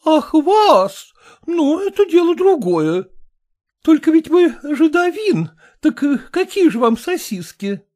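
high, brisk society matron; brassy lady voice. A narrator's reading. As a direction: play the bossy choir mistress. Kolkina voiced by reader.

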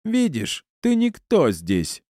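high-pitched, drawn-out gloating; high, mocking woman's taunt; villainess. A narrator's reading. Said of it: "как самая настоящая злодейка".

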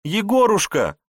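high, loud cry of relief; joyful cry. Acted. Wife flings herself on her husband, joyful cry. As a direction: throw the name out in joy. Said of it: "кинулась ему на шею".

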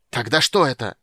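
loud, barked demand; angry bark. A narrator's reading. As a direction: bark the question at her. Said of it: "рявкнул Рейслин". (рявкнуть со злостью)

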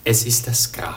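whispered answer, low and grave; hushed revelation. Acted. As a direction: breathe the last word of the riddle. Solemn final answer to riddle. (geflüstert, ernst und leise)

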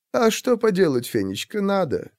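a husband gently calming his worried wife; gentle sigh. A narrator's reading. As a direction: soothe her quietly.

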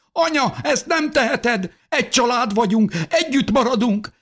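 put-on very high, distraught child's voice; Benjamin, crying. A narrator's reading. Son begs mother not to leave, crying. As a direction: plead desperately in a high voice. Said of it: "szintén sírni kezdett".

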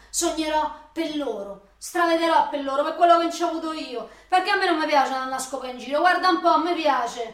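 più acuta e molto più forte; sfogo urlato. Acted. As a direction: grida il suo sogno con rabbia. Sfogo urlato sulla gravidanza. (lei urla a lui il suo sfogo)

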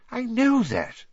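high, irritated retort; irritable. A narrator's reading. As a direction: cut in impatiently, pitched high. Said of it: "said Professor McGonagall irritably".

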